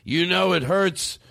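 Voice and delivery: mock a drunk uncle's angry complaint; drunken complaining uncle. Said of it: "just complaining and drunk".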